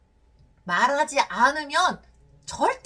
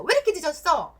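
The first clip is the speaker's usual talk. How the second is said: acted spouse's cross, raised-voice demand; angry scolding; 5 semitones higher, 5 dB louder, faster than usual. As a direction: scold a late partner, higher and louder. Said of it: "화를 내는 거죠". (화내며 다그치는 배우자 말투)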